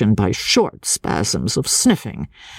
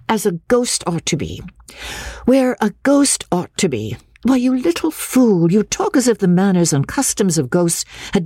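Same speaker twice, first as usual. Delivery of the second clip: high-pitched, exasperated derision; mocking; 8 semitones higher than usual. A narrator's reading. Miss Halcombe scoffs at the little boy's story, mocking. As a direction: throw the child's words back, pitched high. Lady mocks the boy's answer.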